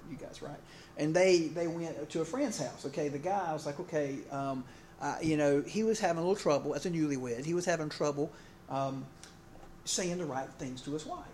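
usual speaking voice

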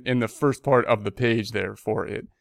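usual speaking voice

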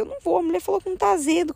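high, fast inner protest; quick, high-voiced reluctance; retold anxious refusal. Retells her embarrassed refusal in thought.

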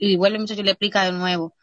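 ordinary delivery

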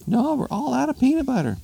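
very high falsetto retort; hammed-up shrieky wife; exasperated wife. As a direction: fire it back in an exasperated squeal. Exasperated wife answers husband.

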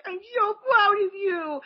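high, slow, mock-tearful parent; gushing proud parent. Parent praising their own kid, hammed-up tearful pride. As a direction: gush it high and drawn out. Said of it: "that would be me to my kid".

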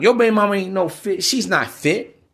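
usual speaking voice

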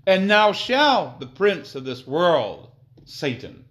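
loud, slow proclamation; declaiming scripture. Acted. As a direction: announce the judgment.

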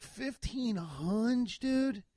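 high, very slow incredulous echo; retold disbelief. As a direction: stretch it out in mock shock at the price. Stunned echo of the quoted price.

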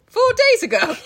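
hammed-up dramatic line; mock dramatic. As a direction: belt it out high like a melodrama.

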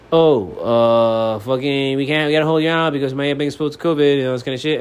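loud, high mock excuse; mocking quote. Mimicking officials holding a player out over covid, mocking.

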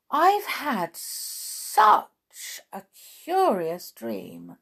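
very slow, drawn-out marvelling; wonder. A narrator's reading. Alice telling her sister of the dream, slow wonder.

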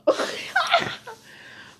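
high, loud laugh; burst of laughter. Real, not acted. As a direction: crack up after the joke. Laughs after acted cheer.

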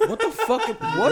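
high, stunned squeak; shocked. Real, not acted. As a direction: squeal it out in disbelief. Stunned reaction, voice jumps.

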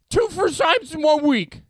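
mock-dramatic punchline. Real, not acted. Punchline of embarrassing story.

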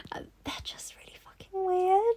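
breathy whispered aside. Real, not acted. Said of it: "whispered aside".

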